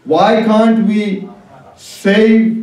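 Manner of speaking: ordinary talk, nothing marked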